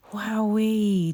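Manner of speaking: loud, bright delighted cry; excited exclamation. A narrator's reading. George seeing snow on Christmas morning, excited cry. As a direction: burst out with joy at the snow. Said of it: "George exclaimed".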